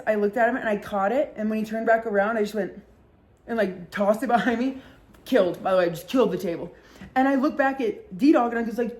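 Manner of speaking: usual speaking voice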